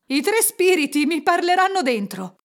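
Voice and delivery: high, loud elation; jubilant vow. A narrator's reading. Scrooge leaps from bed, exultant. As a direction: proclaim it with overflowing joy.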